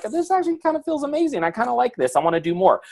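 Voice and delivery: a happy surprise you didn't expect; pleasantly surprised. Pretend. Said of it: "you go, and you're like".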